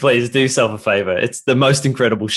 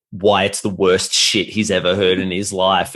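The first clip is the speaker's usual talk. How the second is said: normal talking voice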